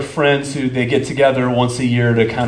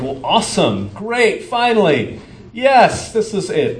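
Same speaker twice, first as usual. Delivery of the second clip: eager delight; 9 semitones higher than usual. Retold or imitated. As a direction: pitch leaps much higher on each word, building. Imagined eager reaction to glory.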